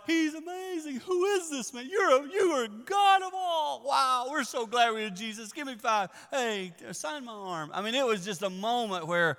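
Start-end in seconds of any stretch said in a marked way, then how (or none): 0.1-7.7 high, loud gushing hype; giddy fans. Retold or imitated. Disciples hyping Jesus like a celebrity, high. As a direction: play the starstruck disciples asking for autographs. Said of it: "the boys are like".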